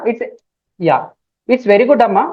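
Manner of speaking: ordinary delivery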